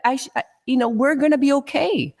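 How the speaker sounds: ordinary talk, nothing marked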